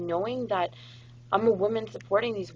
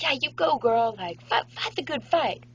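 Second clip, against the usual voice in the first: louder and higher, like a fan cheering her friend on; 7 semitones higher, 5 dB louder than usual. Retold or imitated. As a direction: hype her up, you go girl style. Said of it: "it's kind of like".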